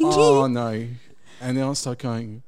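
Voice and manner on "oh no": a sudden louder cry of alarm; the penny drops mid-kiss; alarmed realisation. Retold or imitated. Realising the woman's secret.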